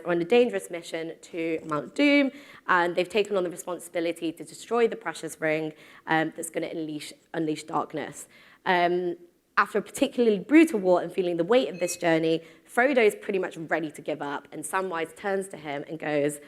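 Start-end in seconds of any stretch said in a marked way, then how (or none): none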